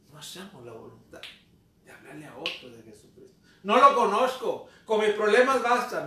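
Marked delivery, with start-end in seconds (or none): 3.6-6.0 loud mock excuse. Quoting believers' dismissive excuse.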